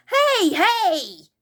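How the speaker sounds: high, loud excited call; excited yell. Acted. Moonface calling out to the children, excited yell. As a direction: shout hello across the field. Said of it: "suddenly yelled an excited voice".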